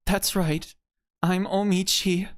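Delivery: character introducing herself. Acted.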